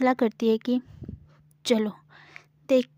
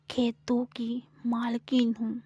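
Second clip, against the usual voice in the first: whispered boast. Acted. Samira whispers to Gauri that she owns the fields.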